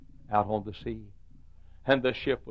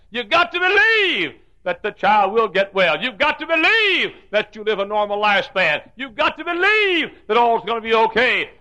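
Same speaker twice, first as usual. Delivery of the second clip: loud, high, climactic preaching; rising to a shouting crescendo of faith; preaching at full force; 11 semitones higher, 11 dB louder than usual. Acted.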